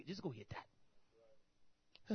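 slow, whispered send-off; whispered dismissal. Retold or imitated. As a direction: breathe it out slowly. Boss dismissing quietly.

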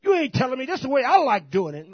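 loud, high mock defiance; hammed-up stubborn employee; defiant shout. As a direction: bark back like a cocky worker. Acting defiant worker.

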